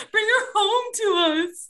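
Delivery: high, slowed, pleading mother's voice; mock her melodramatic begging; exaggerated mother wailing to her son; imitating the mom. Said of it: "That's what she said".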